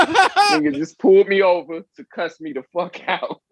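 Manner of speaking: laughing through the punchline; chuckling as he retells it. Real, not acted. Telling a friend about the cop, laughing.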